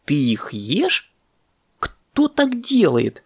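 slow, disbelieving squawk climbing very high; appalled. A narrator's reading. Parrot catches monkey eating bananas, appalled. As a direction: stretch it out in outraged disbelief.

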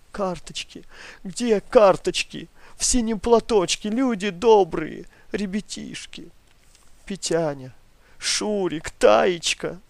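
put-on high, loud keening cry for the children; wailing lament. Old grandmother wailing in her sleep for her lost ration cards. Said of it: "И снова запричитала, пока не в голос".

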